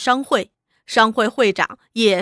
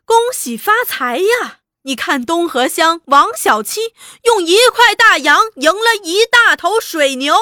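high-pitched, raised barker's patter; 叫卖吆喝; 6 semitones higher, 5 dB louder than usual. A narrator's reading. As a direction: tout big winnings to passers-by. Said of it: "又喊一阵". (扯高嗓门招揽赌客)

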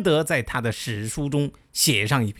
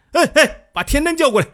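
much higher and louder shout; tipsy call out; 12 semitones higher, 7 dB louder than usual. Retold or imitated. Drunk king at the banquet shouts for Tian Dan. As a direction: yell across the banquet hall. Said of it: "这个齐襄王又喊".

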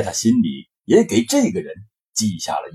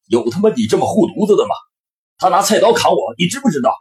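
angry scolding; 6 semitones higher, faster than usual. Acted. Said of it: "刘毅接口骂道".

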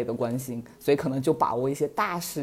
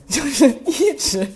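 laughing through the words, slowed; giggling while telling it, drawn out; 8 semitones higher, 5 dB louder, slower than usual. Real, not acted. Telling a friend about the buzzing phone, laughing.

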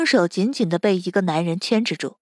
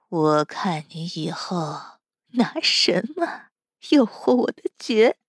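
twisted gloating; 3 semitones lower, slower than usual. Acted. Captor gloats at the cornered captive, slow twisted menace. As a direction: savour the threat slowly. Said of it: "扭曲的笑容".

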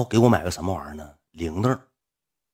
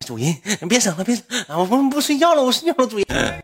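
high squeaky put-on protest; mock squeamish pleading; 13 semitones higher, 3 dB louder than usual. Streamer reluctantly calling supporter master, high mock whine. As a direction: squeal it in a pitch far above your own. 演自己被逼叫主人.

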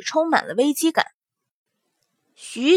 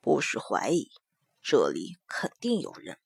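low murmur right in the ear; right in your ear; 12 semitones lower, 12 dB quieter than usual. Acted. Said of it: "在他耳边低语".

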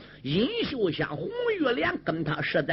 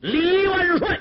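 higher and louder chorus-like cry; hailing shout; 12 semitones higher, 8 dB louder, slower than usual. Acted. Generals hailing Li Yueying as marshal, loud ringing cry. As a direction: hail the new marshal together.

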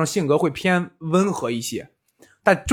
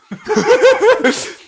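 loud high laughter; high burst of laughter; 5 dB louder than usual. Real, not acted. Telling of the student kowtowing, laughing hard. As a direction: crack up at the memory.

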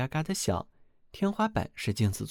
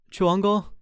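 proud shout; 9 semitones higher, 7 dB louder than usual. Acted. 兄弟得意地回答.

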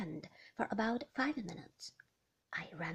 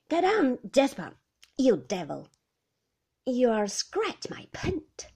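louder, sharp rebuke to Jasper; scolding the dog; 3 semitones higher, 11 dB louder, slower than usual. Acted. Favell shooing Jasper off his car, loud scolding. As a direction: bark it at the dog.

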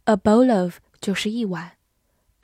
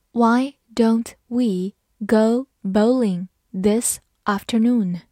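slow read; slower than usual. Shown as a demonstration. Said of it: "好，慢慢来读".

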